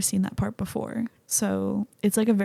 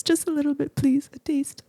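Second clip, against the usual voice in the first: pleading; 6 semitones higher than usual. Real, not acted. Begging to see muscles.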